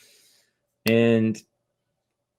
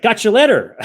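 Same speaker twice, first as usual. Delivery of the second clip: mock official; 10 semitones higher, 6 dB louder than usual. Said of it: "He's like".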